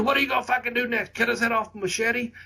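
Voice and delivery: high, rapid imitation of an incredulous Bostonian; Kevin Sullivan. Veteran quizzing young wrestlers on their next move, high incredulous imitation. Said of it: "it's Kevin in his Boston accent still today".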